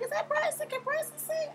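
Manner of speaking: high, startled double take; high incredulous question; surprised. Retold or imitated. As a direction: squeak it out in disbelief. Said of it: "I was like".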